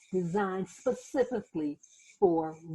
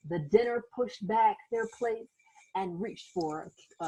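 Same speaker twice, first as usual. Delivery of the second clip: usual speaking voice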